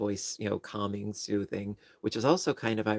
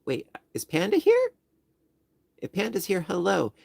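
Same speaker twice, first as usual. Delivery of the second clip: pleased surprise; 9 semitones higher, 5 dB louder than usual. Real, not acted. Spots a friend in chat.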